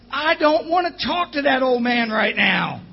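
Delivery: loud fed-up shout; retold shout. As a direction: yell you won't take the call. Refusing the phone call.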